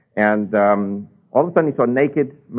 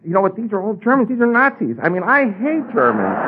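shocked realisation; 10 semitones higher than usual. Retold or imitated. Retold shocked realisation about Germans.

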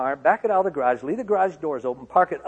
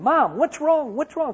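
high, loud panicked calling out; frantic yell; 9 dB louder, slower than usual. Retold or imitated. Son running through the house to his weeping mother, frantic yell. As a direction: shout for your mother in alarm.

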